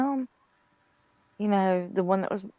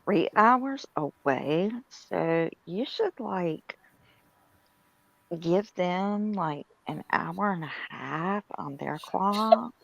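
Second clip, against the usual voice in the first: put-on Valley Girl; slower than usual. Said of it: "you do a perfect Valley Girl".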